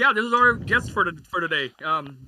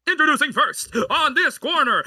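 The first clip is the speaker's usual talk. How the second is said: ring announcer; 6 semitones higher, 5 dB louder, slower than usual. Shown as a demonstration. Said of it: "do that hit introduction".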